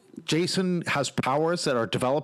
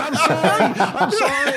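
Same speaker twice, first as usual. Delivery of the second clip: loud, high-pitched comic wail; retold panic, pitch climbing; mock panicked apology; 12 semitones higher, 8 dB louder than usual. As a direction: act out a frantic apology, half laughing.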